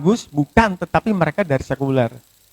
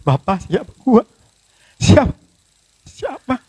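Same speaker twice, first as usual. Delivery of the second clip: a choked, breaking question to God; tearful plea; 6 semitones higher than usual. Retold or imitated. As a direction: ask again and again, near tears. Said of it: "Saya justru menangis".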